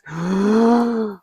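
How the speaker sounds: loud, high sharp intake of breath; deep inhale. Shown as a demonstration. Coach demonstrating the inhale, loud sharp gasp. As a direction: suck the air in deep. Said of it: "Now inhale deep".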